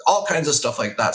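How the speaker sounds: usual speaking voice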